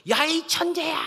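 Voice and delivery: very high, loud acted holler; child's mock shout. Child on the mountain calling out praise, high shout. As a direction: yell up at the mountain, full voice.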